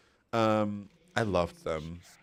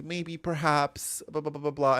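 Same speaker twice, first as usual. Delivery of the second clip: mock British hedging; 5 semitones higher than usual. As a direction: drag out the polite waffle. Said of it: "and it'll be like".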